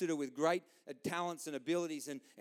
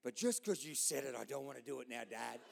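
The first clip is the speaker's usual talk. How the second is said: put-on lower, softer teenage grumble; sulky son; 4 semitones lower, 4 dB quieter than usual. Son answering his dad, low and sulky. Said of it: "He's like".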